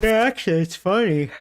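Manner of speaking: talking through a yawn; stretched, yawning words. Real, not acted. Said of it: "I'm sorry, I'm yawning".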